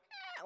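tiny high-pitched yap; short squeaky dog yelp; annoying yapper bark. Retold or imitated. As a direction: imitate an annoying little dog. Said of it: "the annoying yapper".

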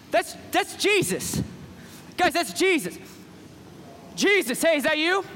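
high-pitched amazed calling out; acted breathless amazement; excited recognition. Peter calls out to Jesus.

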